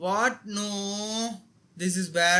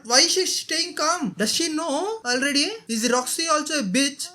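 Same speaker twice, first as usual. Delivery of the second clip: shocked disbelief; 7 semitones higher, 4 dB louder than usual. Real, not acted. Shocked at character's calm.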